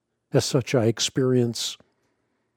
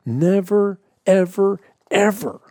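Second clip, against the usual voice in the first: "Never, ever, ever" louder and higher, each word punched; hammed-up forceful insistence; mocking emphatic quote; 6 semitones higher, 4 dB louder than usual. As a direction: mimic a preacher hammering the point. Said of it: "going so far as to say".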